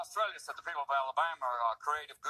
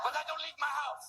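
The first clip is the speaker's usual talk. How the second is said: very high mock threat; offended French meth addict; 10 semitones higher than usual. Offended addict threatening the comics, very high mock voice. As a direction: threaten in a tiny high caricature. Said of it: "now we've offended French meth addicts".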